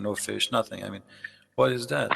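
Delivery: normal talking voice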